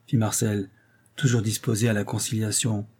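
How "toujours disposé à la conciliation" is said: plain reading voice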